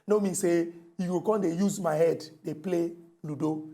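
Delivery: ordinary delivery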